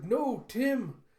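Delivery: put-on high, loud boy crying out; distressed child. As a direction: call after the dog in despair. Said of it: "And Andy's like".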